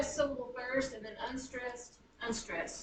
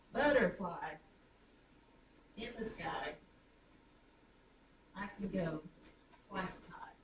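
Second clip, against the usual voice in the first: lower, with pauses after "Butterfly", "sky" and "go"; foot by foot; 4 semitones lower, 5 dB quieter than usual. Shown as a demonstration. Teacher having students count poetic feet, chunked delivery. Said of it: "How many poetic feet are there".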